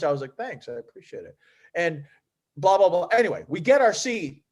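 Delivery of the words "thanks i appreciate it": soft, low-key thanks; unflinching. Retold or imitated. Answering an anxious player's reassurance, unflinching and quiet. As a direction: acknowledge it quietly, giving nothing away. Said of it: "I didn't even flinch".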